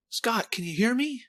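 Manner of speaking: high, questioning woman's voice; acted Wasp call. Wasp calling to Scott, high searching voice. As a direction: call gently, pitched well up. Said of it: "And then Ant Lady, Wasp, goes".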